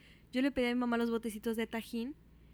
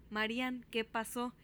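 normal talking voice

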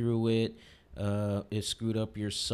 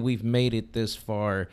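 usual speaking voice

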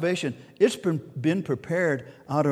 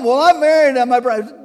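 hammed-up whiny excuse voice, louder and higher; mock excuse; 7 semitones higher, 7 dB louder than usual. Preacher mimicking a guest who won't come, hammed-up excuse. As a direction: play the guest making a lame excuse.

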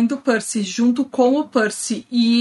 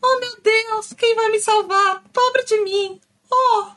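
high, hammed-up damsel in distress; tragic heroine sorrow; 9 semitones higher, slower than usual. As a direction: wail it like a helpless damsel, mock tragic. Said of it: "não ficasse".

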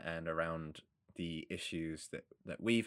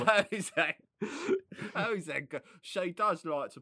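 high, loud imitation of a London fishmonger; hammed-up London accent, pitched up; mock London accent; 11 semitones higher, 8 dB louder than usual. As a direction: put on a London market porter's accent. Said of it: "I find the accent really hilarious".

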